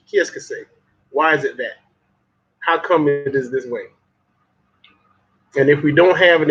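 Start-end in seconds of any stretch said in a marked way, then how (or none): 0.1-0.7 whispered French qui est-ce que c'est; a breathy French aside, qui est-ce que c'est; French question. Shown as a demonstration. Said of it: "in French".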